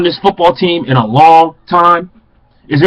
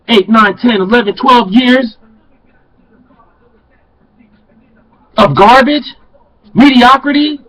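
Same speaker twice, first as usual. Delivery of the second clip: builds higher and louder into a yell; rising angry rant; 7 semitones higher, 3 dB louder than usual. Real, not acted. Longtime fan venting at Kool-Aid drinkers, louder and higher to a yell. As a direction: climb from firm to furious shouting.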